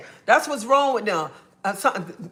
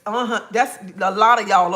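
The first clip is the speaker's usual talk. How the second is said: ordinary talk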